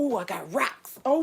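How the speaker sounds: usual speaking voice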